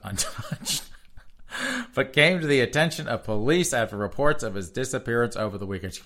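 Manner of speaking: ordinary talk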